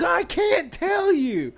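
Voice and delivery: very high, loud, stretched wail; hammed-up slow child whine; mock wailing kid. Kid refusing to tell the dad, exaggerated mock wail. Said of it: "what he kept telling us".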